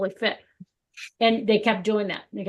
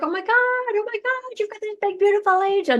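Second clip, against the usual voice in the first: much higher, thrilled exclamation; excited; 12 semitones higher than usual. Retold or imitated. Telling her husband about his angel, a thrilled gush. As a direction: gush about the wonder you see.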